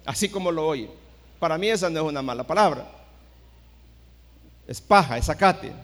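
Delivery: usual speaking voice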